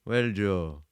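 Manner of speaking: put-on high, loud Indian guru accent; Sadhguru accent. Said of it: "I'm gonna do a Sadhguru accent".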